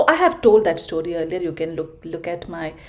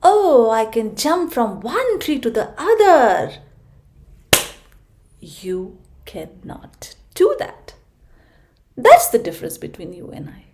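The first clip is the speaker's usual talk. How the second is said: higher, louder show-off monkey; boastful monkey; 4 semitones higher, 6 dB louder than usual. Acted. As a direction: brag like a cheeky monkey. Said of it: "The monkey says".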